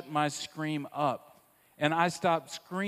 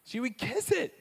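tender lift; 10 semitones higher than usual. Real, not acted. Tender memory of mom.

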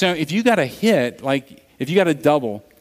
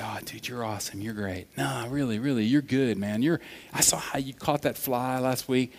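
hushed aside to a teammate; imitated encouraging friend; 8 dB quieter than usual. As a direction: talk like a buddy quietly building someone up. Friend privately encouraging him, acted.

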